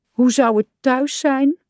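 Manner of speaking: high, plaintive little question; mildly mournful; sad. A narrator's reading. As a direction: wonder it wistfully, pitch lifted. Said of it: "vroeg hij treurig".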